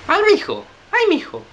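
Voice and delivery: loud, very high granny falsetto; overdone old woman squeak; little old lady voice. Acted. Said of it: "con su voz de viejecita".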